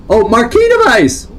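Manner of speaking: high, loud, laughing discovery; excited realization. Real, not acted. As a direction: burst out as it finally clicks. Finally spots the missing clue.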